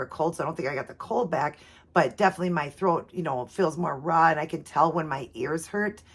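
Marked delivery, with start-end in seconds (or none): none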